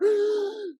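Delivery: sudden high gasp of shock; sharp gasp. Retold or imitated. Friend retelling the shock of the sound, sharp gasp. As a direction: gasp like something startled you.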